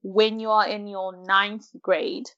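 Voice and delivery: ordinary talk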